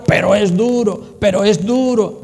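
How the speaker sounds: "duro" weighed down as a mock complaint; stress on duro. Shown as a demonstration. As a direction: grumble over "duro". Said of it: "No pongas el énfasis en el "pero es duro". (queja fingida sobre "duro")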